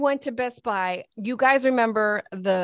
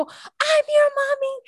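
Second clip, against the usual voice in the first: high, slow call, much higher; pleading call; 4 dB louder than usual. Retold or imitated. Mom calling to her toddler that she is her mommy, high slow call. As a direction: call out to reclaim your child. Mother calling to daughter in store.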